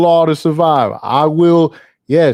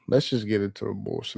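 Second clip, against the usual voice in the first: soft mutter; 5 semitones lower, 13 dB quieter than usual. Real, not acted.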